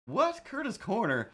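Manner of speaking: high astonished squeak; mock surprise. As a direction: act amazed, pitch up. Retold surprised reaction.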